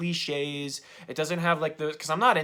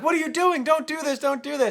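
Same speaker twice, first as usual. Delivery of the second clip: much higher-pitched mock scolding; high, pleading mock protest; chewing him out; 12 semitones higher, 4 dB louder than usual. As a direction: imitate a frantic friend telling him off. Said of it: "he's chewing him out".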